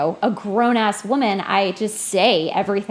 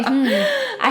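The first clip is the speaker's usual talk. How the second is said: laughing. Real, not acted. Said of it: "laugh heard".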